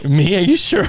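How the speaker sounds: high-pitched incredulous questioning; mock disbelief. Man whose proposal was just accepted, mock disbelief. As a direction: squeak it out as if you can't believe it.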